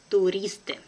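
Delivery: brisk, natural native speed; quick native pace. Shown as a demonstration. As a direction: say it fast like a native. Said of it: "second time will be quickly, like a native".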